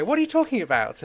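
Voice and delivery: high-pitched, baffled question; mock incredulous. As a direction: act out baffled disbelief. Disciples baffled by Jesus' question.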